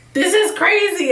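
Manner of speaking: high, slow, exaggerated disbelief; mock John saying it's crazy; imitating John. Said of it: "what if John was like".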